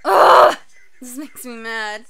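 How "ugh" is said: frustrated groan. Real, not acted. Angry groan at scene.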